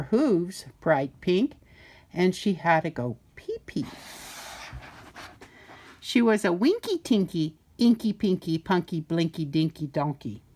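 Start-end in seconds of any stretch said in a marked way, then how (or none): none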